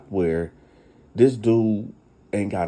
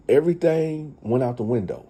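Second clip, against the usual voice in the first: ordinary talk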